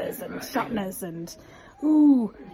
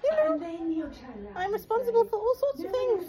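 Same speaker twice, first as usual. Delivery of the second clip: much higher, squeaky little puppet voice; rat puppet voice; 12 semitones higher than usual. Pretend. Mum voicing the rat puppet to amuse her child, much higher squeaky voice. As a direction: make the toy rat greet the child.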